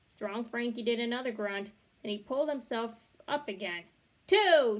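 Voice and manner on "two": loud, high counting shout. A narrator's reading. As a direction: bark out the count. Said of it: "shouted roommate".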